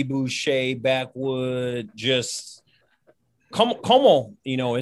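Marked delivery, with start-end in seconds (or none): 3.5-4.4 put-on Cajun accent. Ex-player mimicking South Louisiana folks, loud Cajun accent. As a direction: belt it like a backwoods Cajun. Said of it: "that, that accent".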